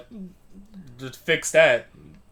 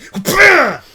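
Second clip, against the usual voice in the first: loud cackle, pitched up; loud high laugh; 16 dB louder than usual. Real, not acted. Loud laugh at own joke.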